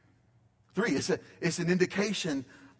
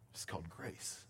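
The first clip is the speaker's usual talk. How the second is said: much softer and lower, nearly breathed; hushed echo; 8 semitones lower, 17 dB quieter than usual. Acted. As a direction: echo the words as a hush. Repeated line, second much softer.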